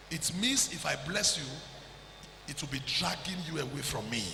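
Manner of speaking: ordinary talk, nothing marked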